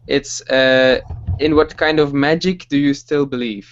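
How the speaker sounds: normal talking voice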